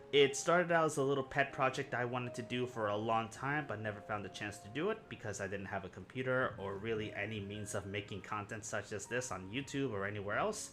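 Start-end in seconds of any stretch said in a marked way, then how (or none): none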